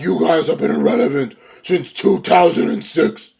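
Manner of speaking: high put-on promo voice; mock a wrestler sneering at his rivals; imitated wrestler's taunt.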